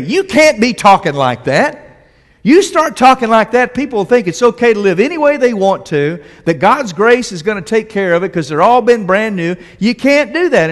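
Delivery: objecting religious folks. Retold or imitated. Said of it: "the religious folks began to say".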